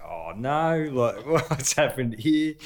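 slow, high, laughing dismay; laughing bewilderment. Retold or imitated. Player caught out by coach, laughing dismay. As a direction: drag it out in laughing disbelief.

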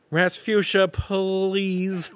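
high, drawn-out plea; whiny put-on cartoon dad; Willy voice. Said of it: "I did my Willy voice for".

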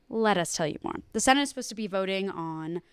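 ordinary talk

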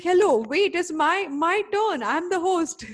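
high, loud mock outrage; indignant protest. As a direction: playfully insist it's your turn. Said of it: "And I'm like".

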